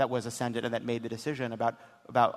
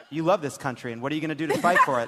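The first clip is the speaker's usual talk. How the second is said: mock patriotic inner monologue; 2 semitones higher, 7 dB louder, faster than usual. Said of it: "I sat there and I thought to myself".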